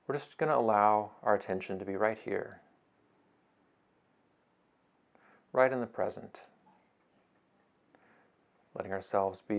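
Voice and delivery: soft, unhurried meditation voice with long pauses; guided meditation. Acted. Teacher guiding listeners through a beach breathing meditation, soft and unhurried. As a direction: ease them gently through each breath, phrase by phrase.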